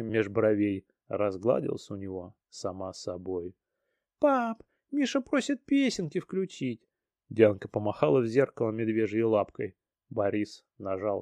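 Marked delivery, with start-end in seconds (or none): 4.2-6.8 put-on high child's voice; excited little girl. Daughter asks dad.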